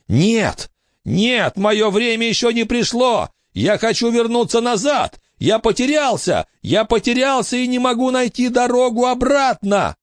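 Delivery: high desperate wail; panicked scream. A narrator's reading. The ghost screaming through Billy that he is lost. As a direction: cry out, lost and terrified. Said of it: "он услышал свой крик".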